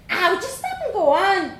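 put-on high, bold Dublin girl voice; young Dublin girl. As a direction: play a cheeky little Dublin girl. Vanessa impatient.

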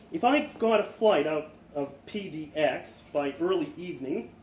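plain reading voice

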